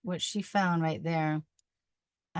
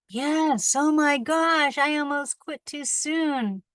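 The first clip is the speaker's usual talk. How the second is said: high, loud relieved exclamation; excited; 9 semitones higher, 6 dB louder, slower than usual. Real, not acted. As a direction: burst out with relief, slowing down. Finds the end, relieved.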